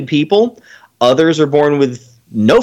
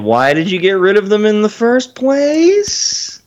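exasperated question; 7 semitones higher, slower than usual. Real, not acted. Scolding the school district.

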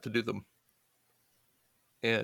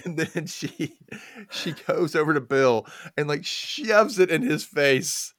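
amused, slowed down; fighting back laughter; laughing while telling; 7 semitones higher, 6 dB louder, slower than usual. Real, not acted. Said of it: "listener laughing".

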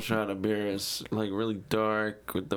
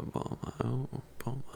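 swooning whisper; 6 dB quieter than usual. Real, not acted. Swooning over Bulma.

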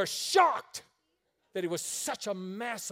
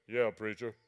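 deep voice of big man; 9 semitones lower than usual. Retold or imitated. Big defensive-end man answering the preacher, lower. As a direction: rumble it like a huge linebacker. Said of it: "his voice is like".

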